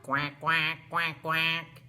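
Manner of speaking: low, punchy run of quacks; low duck quacks, evenly spaced; duck quacking. Shown as a demonstration. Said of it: "Can we quack?".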